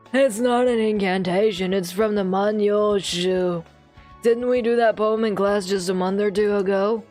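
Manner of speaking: lightly voiced old teacher; old man voice. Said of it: "he's still getting the old man voice".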